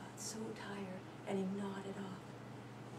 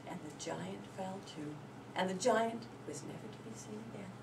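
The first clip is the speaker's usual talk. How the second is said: ordinary delivery, nothing marked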